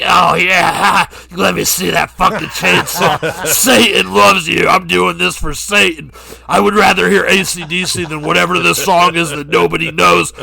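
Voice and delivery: put-on menacing glee, loudly. Acting [name]'s reply.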